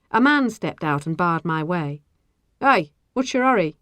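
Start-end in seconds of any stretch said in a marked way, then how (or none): 2.6-3.8 higher, louder challenge; mildly wary questioning; suspicious. A narrator's reading. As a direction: stop someone with suspicion. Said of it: "he asked suspiciously".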